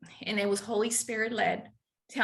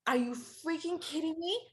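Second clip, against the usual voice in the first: hushed start jumping to a loud, high outcry; acted disbelief; 7 semitones higher, 5 dB louder than usual. Runner retelling her Google discovery, incredulous. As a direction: recoil in disbelief at the figure. Retelling shock at the race distance.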